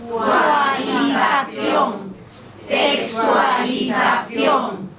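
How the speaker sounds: drawn-out chanted syllable drill on an even pitch; very slow, syllable by syllable. Shown as a demonstration. Coach leads class building a long word backwards, very slow syllables. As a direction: stretch every syllable evenly, leading a group chant. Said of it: "muy despacio".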